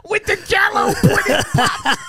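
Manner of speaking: high, laughing celebrity impression; Bill Cosby. Friend mocks the bad accent, high laughing celebrity impression. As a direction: do a goofy high-pitched catchphrase impression. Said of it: "as good as my Bill Cosby".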